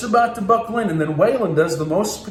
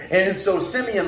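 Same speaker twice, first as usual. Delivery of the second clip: usual speaking voice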